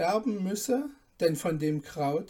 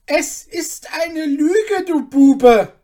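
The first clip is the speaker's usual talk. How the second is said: much higher, much louder, slow shout; a lord spitting accusation at his cook; raging outburst; furious duke; 10 semitones higher, 14 dB louder, slower than usual. Acted. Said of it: "erwiderte der Herzog".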